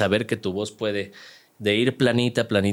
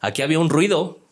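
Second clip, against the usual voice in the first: inner alarm voice; 7 semitones higher than usual. Retold or imitated. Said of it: "tu cerebro te decía".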